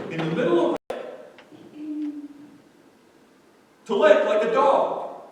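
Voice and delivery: high, loud, emphatic sermon voice; full-force preaching. Real, not acted. Preacher explains the second word to congregation, full-force preaching. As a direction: drive the point to the back row.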